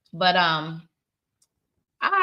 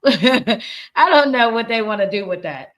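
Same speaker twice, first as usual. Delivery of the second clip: laughing, drawn out and louder; amused bafflement; 3 semitones higher, 7 dB louder than usual. Real, not acted. Host laughs off what people say about accents, amused bafflement. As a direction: say it slowly through a laugh. Laughs while puzzling over others' opinions.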